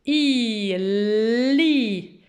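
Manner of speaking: long "i", then "li" with held L; syllable picked apart. Shown as a demonstration. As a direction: draw out "i", then blend slowly into "li". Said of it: "on peut décortiquer". (« i », puis « li », sons étirés)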